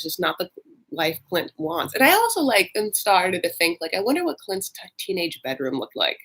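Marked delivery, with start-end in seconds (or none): none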